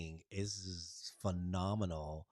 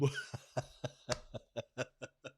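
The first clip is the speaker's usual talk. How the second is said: real chuckling burst after a punchline; laughing; 4 semitones higher, 4 dB quieter than usual. Real, not acted. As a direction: break into a long run of chuckles. Laughs after saying boom.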